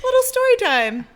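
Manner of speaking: very high, cutesy loud lilt; playful echo. Real, not acted. Playfully echoes co-host's phrase.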